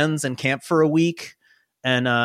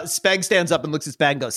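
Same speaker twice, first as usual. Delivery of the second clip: ordinary delivery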